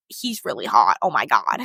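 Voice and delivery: low, creaky swooning aside; low-pitched mock awe; awed aside. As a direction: drop your voice, smitten. Sees sleeping husband's face.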